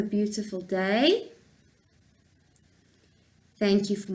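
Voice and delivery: slow prayer with lifted phrase ends. Real, not acted. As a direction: pray slowly for little ones to follow. Leads children's prayer.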